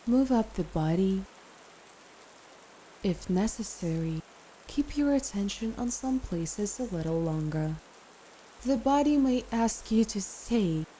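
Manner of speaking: calm meditation guide with long pauses; guided meditation. Acted. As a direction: ease the listener into rest, phrase by phrase.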